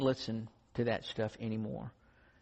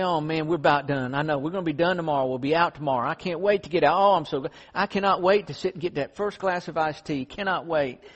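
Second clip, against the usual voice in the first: louder, excited mock craving for iced tea; tired hikers dreaming aloud of iced tea; eager longing; 4 semitones higher, 7 dB louder than usual. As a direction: bubble over about getting out. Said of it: "And they would say".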